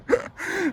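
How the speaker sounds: a quick, high burst of laughter; short high laugh. Real, not acted. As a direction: laugh briefly at your own joke. Laughs at own remark.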